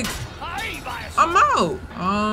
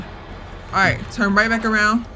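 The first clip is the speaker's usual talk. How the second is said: ordinary talk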